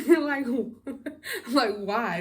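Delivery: high, loud, laughing disbelief; real giggles breaking into the question. Real, not acted. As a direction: laugh through the words. Laughing at own outburst.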